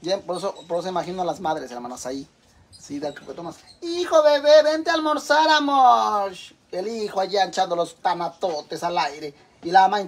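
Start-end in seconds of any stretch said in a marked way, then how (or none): 3.8-6.3 high, loud, sugary call to a grown son; doting mother. Retold or imitated. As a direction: coo like a spoiling mom calling him to breakfast. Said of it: "mother calling pampered son".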